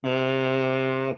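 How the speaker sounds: long, steady one-note nasal N hum; humming demo. Shown as a demonstration. Yoga teacher showing students the Bhramari vibration, sustained humming. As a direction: hold a vibrating hum on one even pitch. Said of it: "एन का pitch vibrate करना है इस तरह से".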